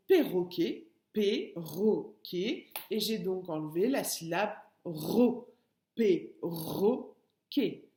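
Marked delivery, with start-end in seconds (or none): 1.1-2.7 "perroquet" slowly split into three separate syllables. Shown as a demonstration. Teacher showing pupils the hidden syllable, "perroquet" split syllable by syllable. 5.9-7.9 "perroquet" very slowly, in three detached beats; syllable by syllable. Shown as a demonstration. Teacher repeating the answer for pupils, "perroquet" split syllable by syllable.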